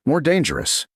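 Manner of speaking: usual speaking voice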